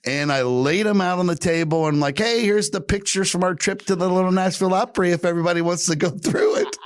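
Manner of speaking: high, lightly voiced grandma; chirpy high old lady telling a story; sweet old lady. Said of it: "And she goes".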